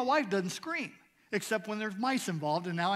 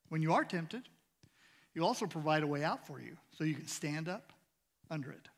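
softer, measured reading of the verse; scripture reading; 5 semitones lower, 6 dB quieter than usual. Acted. Pastor reading First Corinthians to the church, quiet reverent reading. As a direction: read it reverently to the congregation.

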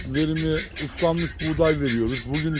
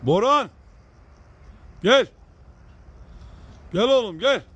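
calling a dog loudly, higher; talking to a dog; 6 semitones higher, 4 dB louder than usual. Real, not acted. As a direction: shout for the dog to come.

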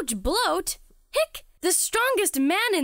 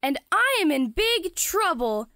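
ordinary delivery

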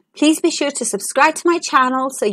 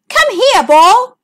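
high, loud call after the ball; yelling; 8 semitones higher, 5 dB louder, slower than usual. A narrator's reading. Princess yells at her ball sinking in the pond, loud and high. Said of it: "she yelled".

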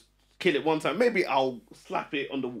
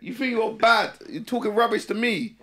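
mock scolding; 6 semitones higher than usual. Acting out scolding his partner.

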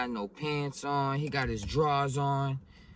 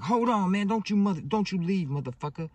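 loud, high retold holler; acted-out shout to wait, pitch raised; urgent calling out; 9 semitones higher, 6 dB louder than usual. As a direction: yell after a driver about to pull away. Calling to driver backing away.

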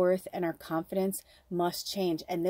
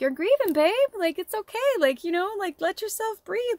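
higher, gentle imitation of her aunt; aunt's voice; 12 semitones higher, 4 dB louder than usual. Medium hearing her late aunt comfort her, higher gentle voice. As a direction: voice the aunt soothing her, tender and high. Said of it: "she's like".

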